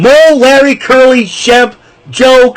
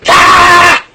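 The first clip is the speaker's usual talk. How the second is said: higher, louder put-on yell; mock scream; 10 semitones higher, 5 dB louder than usual. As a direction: scream out the frustration, hammed up. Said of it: "I feel like screaming".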